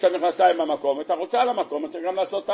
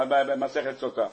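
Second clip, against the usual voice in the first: ordinary delivery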